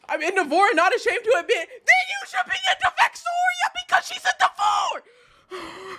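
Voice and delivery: high-pitched shout building to a squeal; real worked-up screech; exasperated outburst. Real, not acted. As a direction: build from indignant to a very high yell. Exasperated outburst, arguing with chat.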